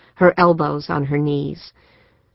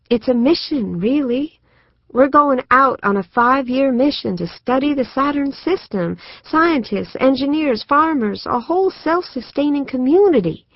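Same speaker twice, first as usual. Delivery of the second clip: higher pitched, eager and bright with plans; genuinely excited; 8 semitones higher than usual. A narrator's reading. Susan selling her sister on the mission, excited. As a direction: gush like a kid heading to a thrill park. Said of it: "her sister was genuinely excited".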